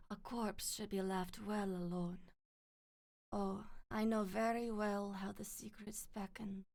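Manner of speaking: very soft, measured, ominous voice; quiet, menacing character impression; Lady Maria. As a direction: deliver it hushed and solemn. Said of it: "let me see a Lady Maria quote".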